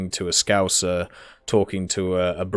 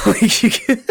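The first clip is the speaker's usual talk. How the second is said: laughing; 14 semitones higher, 6 dB louder than usual. Real, not acted. Laughing at the absurdity.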